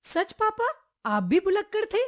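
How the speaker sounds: put-on high-pitched, amazed little girl; astonished. A narrator's reading. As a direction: ask Papa in wide-eyed disbelief. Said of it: "नन्ही हैरानी से बोली".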